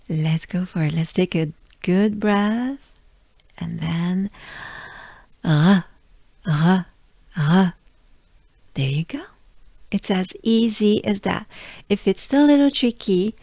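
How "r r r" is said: "R" exhaled with tongue kept down; French R. Shown as a demonstration. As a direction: take a breath, then breathe out a French "R". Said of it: "And pronounce the R".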